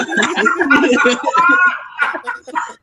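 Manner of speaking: bursting into high, helpless laughter; high laughing fit. Real, not acted. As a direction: cracks up at the memory. Recalling the funny commentator line.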